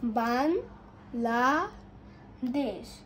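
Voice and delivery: বান-লা-দেশ said very slowly, each syllable held apart; syllables drawn out. Shown as a demonstration. Teacher showing children the three syllables, slowly. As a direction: stretch বান, লা, দেশ one by one. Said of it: "তিনটা, বান-লা-দেশ".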